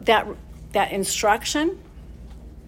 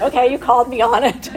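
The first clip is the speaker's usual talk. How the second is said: laughing admission; 3 semitones higher, 7 dB louder than usual. Retold or imitated. Caught out by her son, laughing.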